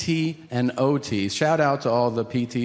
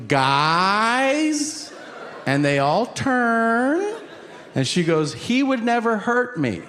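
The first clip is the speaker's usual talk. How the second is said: hammed-up declaration; announcing wife; 9 semitones higher, slower than usual. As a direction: call the room's attention. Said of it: "make an announcement".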